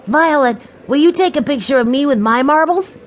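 put-on high, excited child's voice; eager little boy. Benny asking Violet for a picture, eager. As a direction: pipe up like a small boy asking a favour. Benny pleads with his sister.

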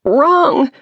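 high panicked squeak rising. Acted. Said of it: "her mind squeaked".

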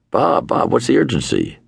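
a hint of a busy diplomat; mock Kissinger. Kissinger returning the producer's urgent call, lightly voiced. Kissinger calling back on the phone.

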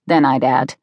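usual speaking voice

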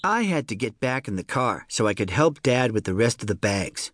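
usual speaking voice

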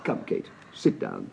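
breathy whisper, soft and low; whispered aside. Acted. Husband quietly inviting his new wife to sit, whispered. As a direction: hush it for Kate alone.

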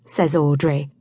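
ordinary delivery, nothing marked

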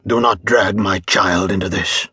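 usual speaking voice

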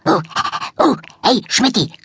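a light eager puppy voice; bouncy little dog greeting; yappy little dog. A narrator's reading. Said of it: "wuffte Bonsai".

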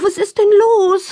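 high, yawning question; drowsy mother stirring from a nap; sleepy question. A narrator's reading. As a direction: ask as if just woken up. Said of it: "fragt sie verschlafen".

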